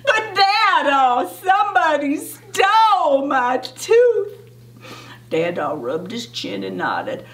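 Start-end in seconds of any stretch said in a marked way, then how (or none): 0.1-4.4 high, slow, drawn-out complaint; wailing kid's protest to Dad; kinda shouted protest. A narrator's reading. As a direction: plead it up high, stretching the words. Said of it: "I kinda shouted".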